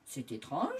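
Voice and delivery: a touch of storybook bafflement; surprised. A narrator's reading. First farmer noticing the curled tail, mildly surprised. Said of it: "fit le premier homme, surpris".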